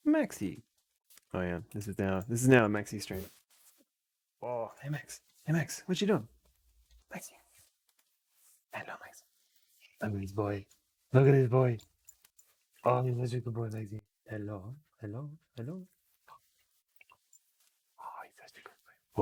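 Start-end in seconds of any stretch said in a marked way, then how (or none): 0.1-0.6 calling the dog. Real, not acted. Dog arrives, calling its name. 4.4-19.2 dog talk. Real, not acted. Cooing at the dog.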